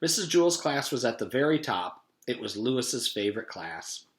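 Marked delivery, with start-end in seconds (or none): none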